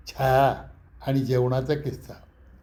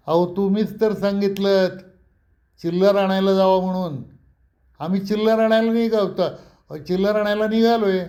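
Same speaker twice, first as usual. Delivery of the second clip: raised, louder mock-innocent answer; cheeky retort; 5 semitones higher, 3 dB louder than usual. Pratap mock-innocently answering the angry owner, raised and cheeky. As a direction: play dumb loudly to needle him. Heated argument with hotel owner.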